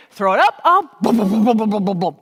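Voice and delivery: loud booming warm-up syllables; throw it up booms. Shown as a demonstration. Teacher leading class in booming warm-up. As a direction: punch each boom out. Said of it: "Okay, ready?".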